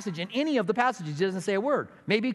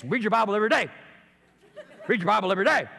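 a hammed-up, sing-song repeat of the phrase; mock face repetition; 2 semitones higher, much faster than usual. Pastor mimicking the conference teacher, with a laugh at the end. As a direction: parrot it with a silly face. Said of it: "And then you make this face".

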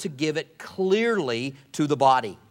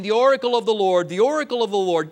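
loud, fast, higher pompous proclaiming; false prophet; 6 semitones higher, 6 dB louder, faster than usual. Retold or imitated. Preacher mocking false prophets standing up, loud pompous proclaiming. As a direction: puff up and announce it like a showy false prophet. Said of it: "Now you can hear these false prophets".

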